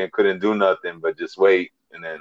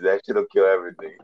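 ordinary talk, nothing marked

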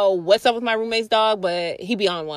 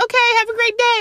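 higher, sing-song polite goodbye; lady's high polite voice; 13 semitones higher, 3 dB louder than usual. Retold or imitated. Lady ending the awkward chat, high and fake-polite. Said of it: "So then she was like".

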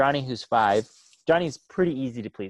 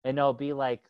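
ordinary talk, nothing marked

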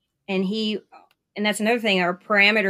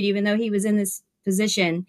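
normal talking voice

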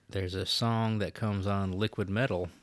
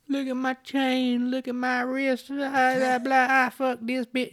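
high, droning parody rap delivery; mock a dumb bling rapper, drawn out; 4 dB louder than usual. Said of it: "like, dumb".

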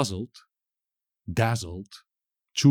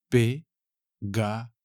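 "Pegajoso" slowly split as pe, ga, jo, so; "Pegajoso" broken up for a learner: pe... ga... jo... so; syllable by syllable; slower than usual. Shown as a demonstration. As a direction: separate each syllable of "Pegajoso" with a pause. Teacher breaks word into syllables.

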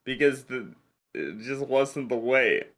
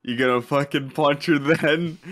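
usual speaking voice

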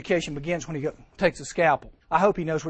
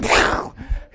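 burst of laughter; 5 dB louder than usual. Real, not acted. Laughing burst after acted quote.

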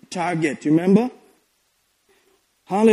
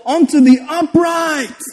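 higher, slow, drawn-out proclamation; declaimed scripture; 7 semitones higher than usual. Acted. Preacher reading scripture to congregation, declaimed slowly. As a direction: proclaim the verse grandly.

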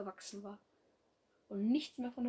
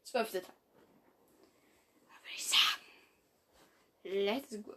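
whisper. Real, not acted. Whispered aside before starting.

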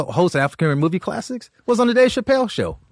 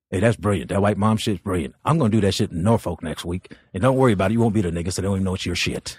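Uncle Ray voice; 10 semitones lower, 4 dB quieter, faster than usual. Retold or imitated. Uncle Ray greets Paul in the green room, low and brash. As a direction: lower gruff greeting. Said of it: "Uncle Ray goes".

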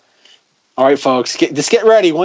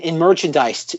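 ordinary talk

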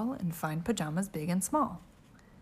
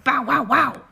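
barking dog; 6 semitones higher, 8 dB louder than usual. Acted.

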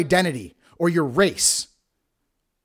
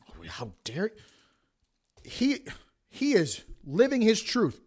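sarcastic mock outrage; mocking whine; 9 dB quieter than usual. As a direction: parody the outraged critics. Mocking imagined critics.

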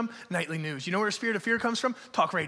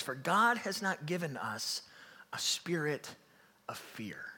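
slow near-whisper, sinking softer; hushed scripture emphasis; 4 semitones lower, 7 dB quieter, slower than usual. Real, not acted. Preacher giving congregation the key verse, slow hushed near-whisper. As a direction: drop to a whisper, phrase by phrase.

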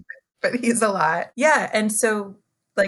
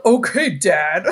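slow, sassy dismissive tune; sassy kids; 5 dB louder, slower than usual. Retold or imitated. Kids being sassy to their other mom, slow and dismissive. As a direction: drag it out with kid sass. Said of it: "Like, with that intonation".

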